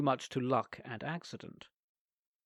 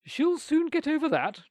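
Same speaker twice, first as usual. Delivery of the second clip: stern aunt; 14 semitones higher, 5 dB louder than usual. A narrator's reading. Aunt voiced in story.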